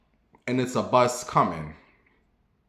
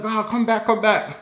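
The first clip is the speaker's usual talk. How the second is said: high pleading come back; 8 semitones higher than usual. Retold or imitated. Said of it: "He's like".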